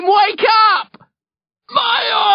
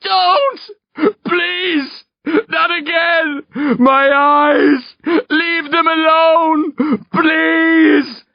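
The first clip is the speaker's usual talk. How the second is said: high, wailing pleas; anguished, drawn-out sobbing cries; crying; 3 dB louder, much slower than usual. A narrator's reading. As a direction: beg through terrified tears. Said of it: "he starts to cry".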